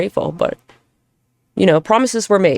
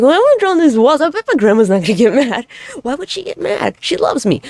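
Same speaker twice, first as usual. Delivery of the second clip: put-on dreamy little kid; 8 semitones higher than usual. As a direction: play the naive kid sure grandma won't mind. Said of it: "I was a very flowery, dreamy kid".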